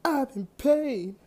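high, loud pained cry for help. A narrator's reading. Wounded hero crying out for help, high pained cry. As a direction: cry out as you hit the ground hurting. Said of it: "I cried out as I fell".

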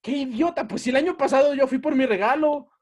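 high-pitched self-reproach; sudden retold realization. Child finally catching on about the regalo, high self-mocking exclamation. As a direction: exclaim it as the penny drops. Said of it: "agarré la onda y dije".

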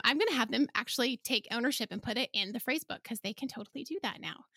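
normal talking voice

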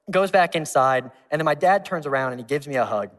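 ordinary talk, nothing marked

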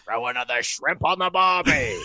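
drawn-out Australian accent demo. Shown as a demonstration. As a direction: show off a slow, hammy Australian accent. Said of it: "I do an Australian accent".

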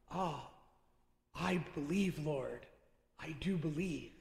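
retold in a soft, low, reverent voice; hushed confession of faith. Blind man confessing to the Lord, hushed and reverent. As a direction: quietly voice the blind man's belief.

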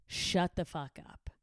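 slow, flat-dropped "Shut the fuck up"; slow deadpan repeat. Real, not acted. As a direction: lay each word down slowly. Repeated slogan slowed.

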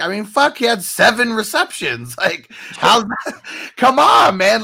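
loud, high exasperated outburst; indignant protest. Real, not acted. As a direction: protest in disbelief, voice pushed up and out. Indignant protest over a player's slight.

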